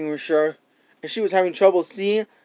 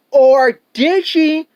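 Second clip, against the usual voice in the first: slow, high, louder suspense question; dramatic teaser; 8 semitones higher, 6 dB louder, much slower than usual. Pretend. Reviewer teases the audience about a death, slow dramatic suspense. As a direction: tease it like a cliffhanger. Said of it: "why I said dun".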